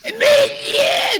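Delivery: loud high shrieking dragon roar. Acted. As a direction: let out a big dragon roar. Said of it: "Great dragon impression".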